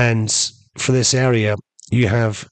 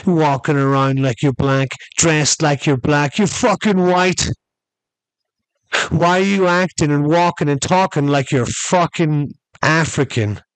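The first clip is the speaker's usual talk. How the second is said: high-pitched imitated challenge; mock confrontation; 5 semitones higher than usual. Man challenging Kareem before the crowd, mock confrontation. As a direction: play a man calling someone out, pitched up. Acted confrontation outside a club.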